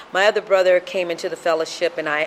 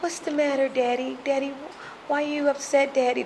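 put-on higher-pitched child pleading with Daddy; imitating little child; 7 semitones higher, 4 dB quieter than usual. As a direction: voice yourself as a concerned child. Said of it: "And, and I go".

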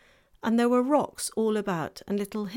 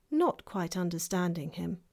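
ordinary delivery

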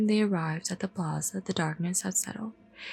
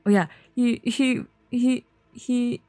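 plain reading voice

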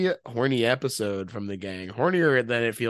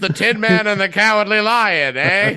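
brash wisecracking dame, louder and higher; 1930s fast-talking dame; 7 semitones higher, 6 dB louder than usual. Retold or imitated. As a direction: snap it out like a thirties dame. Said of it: "That's pretty good".

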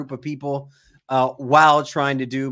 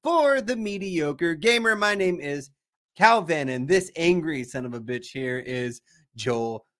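slow, upbeat host announcement; podcast intro; 4 semitones higher, slower than usual. Acted. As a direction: present the show to listeners.

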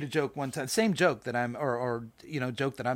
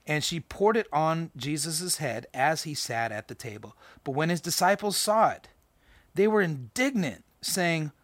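slow, raised Bible reading voice; scripture reading; 4 semitones higher, 4 dB louder than usual. Acted. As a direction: read the passage out with weight. Reads scripture aloud.

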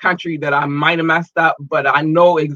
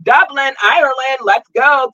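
higher and louder, cheering Dublin on; excited cheer for a city; hyped shout-out; 9 semitones higher, 5 dB louder, slower than usual. Real, not acted. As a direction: hype the crowd up.

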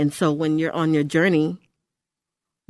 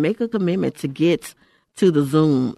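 ordinary talk

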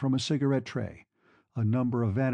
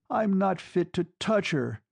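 raised voice, higher, full of sentimental shame; crying aloud; 6 semitones higher than usual. A narrator's reading. Husband alone in her bedroom, crying out aloud in shame. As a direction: cry it out to an empty room. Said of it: "he cried aloud to the four walls".

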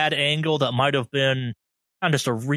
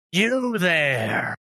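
put-on old hag; 4 semitones higher than usual. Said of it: "looks at you and goes".

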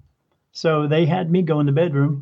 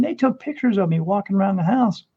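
normal talking voice

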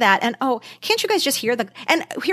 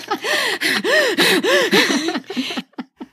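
high delighted laugh; high burst of laughter; 12 semitones higher than usual. Real, not acted. Big laugh.

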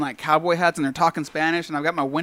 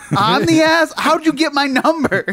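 high, loud indignant comeback; indignant disbelief; 11 semitones higher, 5 dB louder, slower than usual. Retold or imitated. Friend answering Zeke's unexpected text, high mock indignation. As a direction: protest it pitched way up, half laughing.

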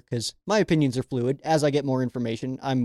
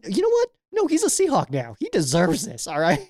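squeaky, much higher mock outrage; 7 semitones higher than usual. Fan mocking a former quarterback now a Seahawk, in high hammed-up outrage. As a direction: pitch jumps way up in hammed-up indignation.

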